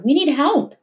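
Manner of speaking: high desperate plea; yelling. A narrator's reading. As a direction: beg the guards for help. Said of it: "Mahmoud yelled".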